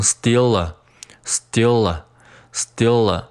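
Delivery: "steal a" joined slowly as "stee-la"; linked words. Shown as a demonstration. Teacher drilling liaison, "steal a" linked slowly as one word. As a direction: carry the l of "steal" into "a". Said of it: "和a进行连读".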